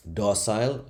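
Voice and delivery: ordinary talk, nothing marked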